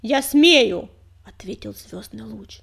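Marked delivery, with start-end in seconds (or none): 0.0-0.9 seething anger. A narrator's reading. Enraged rival defies leader.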